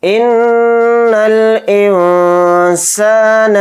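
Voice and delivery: इन्नल with a long nasal hum on the nn; इन्नल chanted slowly with a nasal hold; nasal ghunna on the doubled n. Shown as a demonstration. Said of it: "नून में गुन्ना करेंगे".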